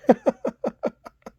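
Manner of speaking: high, mocking chuckle; gloating giggle; smug laugh. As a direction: laugh at the rivals. Champion laughs at his rivals.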